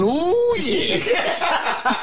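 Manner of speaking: high-pitched gleeful "ooh yeah"; excited squeal. Retold or imitated. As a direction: squeal it like an overexcited mate. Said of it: "he'll be going".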